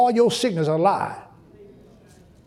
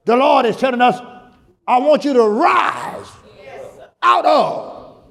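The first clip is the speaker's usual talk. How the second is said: full-force preaching; 6 semitones higher than usual. Real, not acted. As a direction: preach it hard, lifting every phrase.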